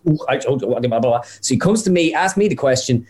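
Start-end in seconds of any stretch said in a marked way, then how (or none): 0.1-1.2 lightly imitated German accent. TV interviewer asking the camera a question in German, hint of German accent. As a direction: gabble mock German syllables, lightly accented. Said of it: "this is my impression of a German accent".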